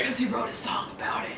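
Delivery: unvoiced, joking aside; teasing stage whisper. Real, not acted. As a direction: whisper the jab. Player whispering a joke.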